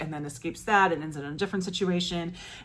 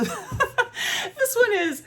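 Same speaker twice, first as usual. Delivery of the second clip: laughing; much slower than usual. Real, not acted. Laughs while introducing book.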